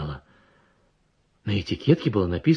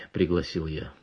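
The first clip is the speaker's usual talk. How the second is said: plain reading voice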